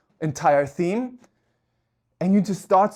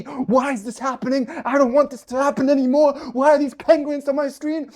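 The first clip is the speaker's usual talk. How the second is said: pitched-up, rising mock freak-out; mock panic; 5 semitones higher than usual. Mocking a viewer who panics over penguin videos, mock panic.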